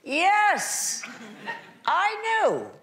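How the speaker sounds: high, knowing mock boast; playfully smug yes. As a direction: sell the joke with smug certainty. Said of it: "mock confident claim".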